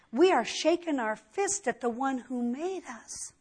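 normal talking voice

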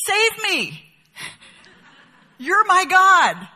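high, loud, drawn-out cry to the idol; idol worshipper's plea. Retold or imitated. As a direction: beg the carved block to save you. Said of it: "listen to what he says to it".

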